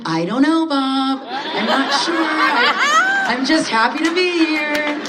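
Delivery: high, lilting sing-song, laughing. Retold or imitated. Deflecting Bob at work in a high sing-song voice. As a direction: float it up high and sweet like a song. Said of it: "you do the sing-song voice".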